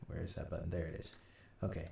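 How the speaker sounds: ordinary talk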